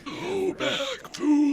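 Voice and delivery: high, eerie inhaled ghost voice; talking while inhaling. Retold or imitated. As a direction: gasp the words in on a breath like a spooky ghost. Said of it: "You just speak while breathing inwards".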